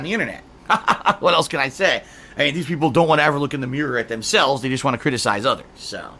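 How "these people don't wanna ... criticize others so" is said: ordinary talk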